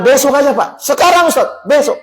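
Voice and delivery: high-pitched insistent haggling; quick retold tug-of-war; retold back-and-forth argument. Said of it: "Ada dua puluh menit debat sama dia".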